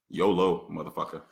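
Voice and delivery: ordinary talk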